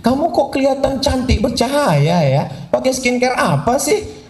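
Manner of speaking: high, curious gushing voice; lightly voiced admiring friend; a hint of a nosy friend. Friend admiring glowing face.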